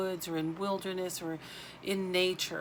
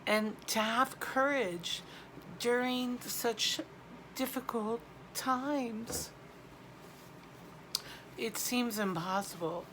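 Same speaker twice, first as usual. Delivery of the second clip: real crying; 4 semitones higher than usual. Real, not acted. Medium consoling viewers in difficult times, crying. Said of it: "I'm crying".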